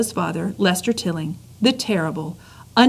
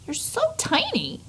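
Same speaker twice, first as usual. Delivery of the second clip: cooing; 11 semitones higher, 7 dB quieter than usual. Acted. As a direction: coo it like adoring a tiny pet. Princess coos at tiny mouse.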